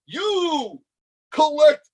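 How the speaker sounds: high-pitched mock royal order; screaming at ants. Self-crowned king giving ants chores, mock yelling. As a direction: pretend to boss tiny subjects around. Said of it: "screaming at my ants".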